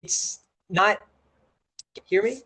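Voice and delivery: usual speaking voice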